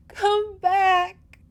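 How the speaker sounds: high, loud, anguished call; wailing. A narrator's reading. Girl calls her lover back through the storm, wailing. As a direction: cry out after someone riding away. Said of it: "she wailed".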